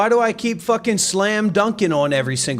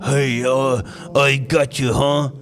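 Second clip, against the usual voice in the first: mock tough actor; 5 semitones lower, slower than usual. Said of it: "because you're talking".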